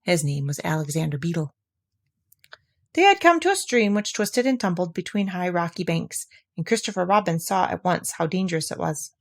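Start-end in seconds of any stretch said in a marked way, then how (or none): none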